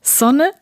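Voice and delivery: Sonne first with a voiceless S, then Sonne with a buzzing Z; southern, then standard. Shown as a demonstration. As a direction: contrast Sonne as Sonne and as Zonne. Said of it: "I never use the Z sound".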